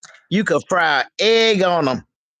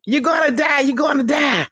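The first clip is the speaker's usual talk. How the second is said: higher mock holler; repeating the alarm, higher; hollering nurse; 11 semitones higher than usual.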